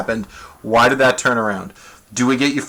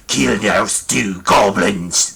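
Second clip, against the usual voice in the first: slow, lower, alien-sounding Draconic; Draconic, drawn out and lower; 3 semitones lower, much slower than usual. Shown as a demonstration. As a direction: say it like a dragon tongue, slowly. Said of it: "But in Draconic".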